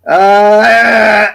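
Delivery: sudden loud burst of sound; loud blurt. Shown as a demonstration. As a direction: blurt it out as if your voice cracks from getting loud. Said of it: "my voice goes".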